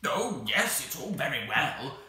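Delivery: loud, high grumbling creature; cross creature. Acted. The Psammead grumbles at Anthea's flattery, cross. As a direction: complain sourly in a squawky creature voice.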